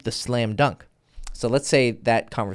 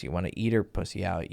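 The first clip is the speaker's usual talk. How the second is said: normal talking voice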